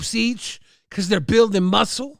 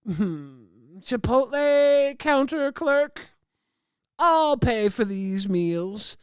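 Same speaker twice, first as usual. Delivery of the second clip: 1 smug slow victor; 3 semitones higher, much slower than usual. Retold or imitated. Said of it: "And I'll be like".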